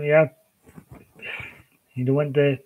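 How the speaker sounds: normal talking voice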